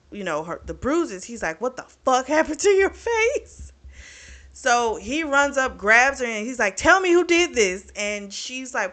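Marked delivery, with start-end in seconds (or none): 1.6-3.7 retold high-pitched shocked outburst; outraged shock. Man seeing her bruised face, shocked outrage. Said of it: "talking him down off the rage". 6.8-7.9 high, loud retold demand; enraged demand. Man grabbing her to learn who hurt her, enraged demand. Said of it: "talking him down off the rage".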